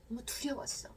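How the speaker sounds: soft breathy whisper of fear. Shown as a demonstration. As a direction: breathe the words out, no voice. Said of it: "라고 여러분의 감정을 이렇게 표현해 보는 거".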